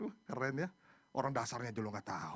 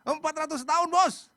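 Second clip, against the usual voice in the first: high, very loud exclamation; worked up; 14 dB louder than usual. Real, not acted. Preacher stressing the years of slavery, worked up. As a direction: insist on it, emotional and emphatic. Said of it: "Sampai emosi nih".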